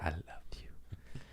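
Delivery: breathy whispered sweet nothing; hushed, syrupy mock-romantic whisper; mock love whisper. Reading mushy notes aloud.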